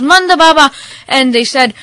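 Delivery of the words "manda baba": high, loud calling out; yelling. Acted. Car passengers calling to Manda Baba, yelling. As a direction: yell his name to get his attention. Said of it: "Everybody who was in the car yelled".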